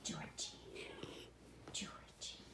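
usual speaking voice

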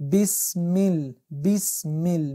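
slow, drawn-out Quran chanting; slow recitation. Shown as a demonstration. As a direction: intone it slowly like tajwid recitation. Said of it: "میم هم باز زیر داره".

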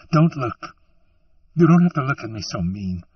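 high, loud, hurried woman's protest; tearful outcry, voice jumping high; upset protest. A narrator's reading. Weeping woman protests at his look.

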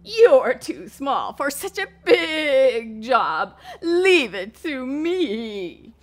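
loud, slow, high horse voice; proud horse. Acted. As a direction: boast like a big horse.

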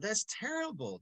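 put-on a kid protesting to the sub pastor; upset kid. Seventh grader protesting to the priest, mock indignant. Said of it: "and I said".

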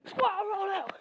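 loud high rallying yell; shout. Real, not acted. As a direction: call the squad to run. Rallying the animals to run.

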